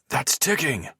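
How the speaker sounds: high, alarmed yell; sudden high cry of alarm. Acted. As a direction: shout the alarm. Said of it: "Wolf yells".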